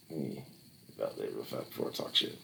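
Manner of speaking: mumbled aside. Real, not acted. Aside while checking a fact, mumbled. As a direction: mutter it to yourself. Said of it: "aside to self".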